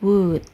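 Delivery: would said as wud, no L sound; would without the L. Shown as a demonstration. Teacher showing students would with a silent L. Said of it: "jangan diucapkan ya L-nya".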